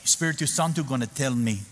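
put-on pin him down in a heavy Spanish accent; imitating a stern Spanish-accented order. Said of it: "She goes".

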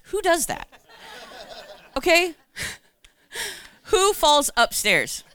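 high, loud, laughing at herself; who falls upstairs, really; amused disbelief. Real, not acted. To the church, laughing at her own fall upstairs, high and loud.

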